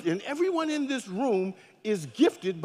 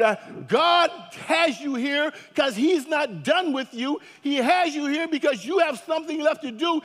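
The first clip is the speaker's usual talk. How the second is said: booming motivational speech; 5 semitones higher than usual. Acted. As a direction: preach it full force to the room. Preaching at full force.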